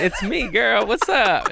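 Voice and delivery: high, loud, playful hello; hammed-up excited flirty greeting; mock eager greeting. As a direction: call out to her way up high. Acts out his eager reply.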